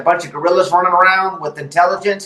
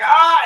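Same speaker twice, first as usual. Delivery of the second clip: high mock yelp of pain; cartoon pain yelp; 10 semitones higher, 3 dB louder than usual. Retelling a burnt hand to friends, mock pained yelp. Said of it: "it was like a cartoon".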